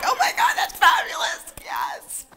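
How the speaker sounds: very high squealing laugh-talk; hammed-up delighted, breathless laughter; acted laughing. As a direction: gush through put-on hilarity. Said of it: "Just keep laughing".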